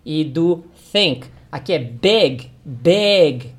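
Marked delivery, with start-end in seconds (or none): 1.8-3.5 "big" said close to "beg"; short I in BIG. Shown as a demonstration. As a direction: say "big" with a lax vowel between i and e. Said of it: "O som também não é de I".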